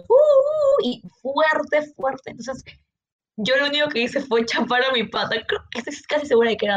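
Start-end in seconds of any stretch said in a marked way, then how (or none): 0.1-0.8 high wailing uh-uh-uh like a fire alarm; alarm imitation. Retelling the door alarm, siren-like imitation. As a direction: mimic a shrill alarm siren with your voice. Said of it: "era como una alarma de incendio".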